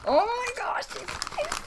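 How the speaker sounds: thrilled squeal. Real, not acted. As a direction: gasp it out at the first sight. First sight of prized plant.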